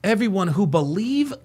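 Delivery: lightly mimicked speechmaker's cadence; mock his put-on inflections; mocking impression. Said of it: "Do you hear his fake voice inflections?".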